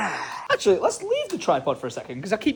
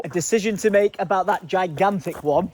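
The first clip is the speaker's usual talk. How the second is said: ordinary talk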